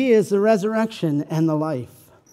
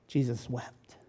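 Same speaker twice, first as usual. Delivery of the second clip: much softer and lower; hushed; 6 semitones lower, 9 dB quieter than usual. Acted. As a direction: let it land softly. Solemn point of compassion.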